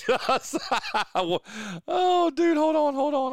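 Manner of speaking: high laughing through words. Real, not acted. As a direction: crack up mid-sentence. Laughing at joke.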